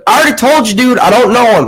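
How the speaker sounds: high, hammed-up Southern protest; Southern accent. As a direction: imitate an irate old Southern man. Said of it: "he had a southern accent".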